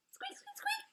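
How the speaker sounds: little bird. Acted. The unafraid bird squeaking back softly at the Yeti. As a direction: peep like a tiny unafraid bird.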